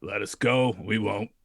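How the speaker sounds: put-on tough-guy Brooklyn accent, shouted out. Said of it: "Your Brooklyn accent makes me so happy".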